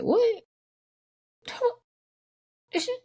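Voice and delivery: high, stunned "What?"; shocked disbelief. Retold or imitated. Answering grandma's compliment in high mock shock.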